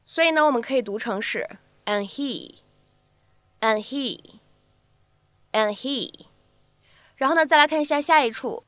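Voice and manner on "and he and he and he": "and he" with the d unreleased, slow; incomplete plosion. Shown as a demonstration. Teacher models "and he" with incomplete plosion. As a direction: hold back the d in "and he". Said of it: "会有一个不完全爆破的处理".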